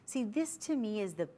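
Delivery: ordinary talk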